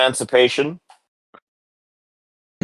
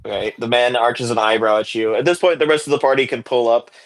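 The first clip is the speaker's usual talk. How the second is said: ordinary talk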